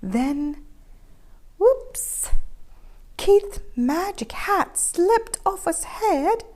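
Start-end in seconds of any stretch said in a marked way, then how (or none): none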